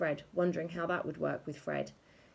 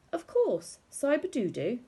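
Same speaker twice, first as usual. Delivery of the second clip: higher, mock agreement; sarcastic; 8 semitones higher, 4 dB louder than usual. A narrator's reading. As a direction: humour them with fake recognition. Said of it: "said Ellie".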